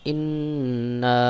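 ইন্না with a long humming nn and drawn final aa; ghunnah. Shown as a demonstration. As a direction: hold the doubled n in ইন্না with a nasal hum. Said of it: "এখানেও গুন্নাহ করতে হবে".

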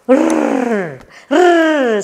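high, long "rrr" like a tugging puppy; drawn-out growled R sound; playful puppy growl. Pretend. Teacher as puppy pulling the rag, high playful "rrr". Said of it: "puppy pulls the rag".

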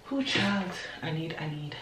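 ordinary talk, nothing marked

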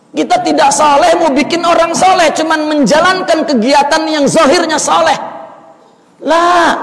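high, loud, rising scolding sermon; preaching at full force. Acted. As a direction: drive the rebuke home from the pulpit.

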